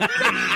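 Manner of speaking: short high burst of laughing; hysterical laughter. Real, not acted. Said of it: "I literally was losing my shit".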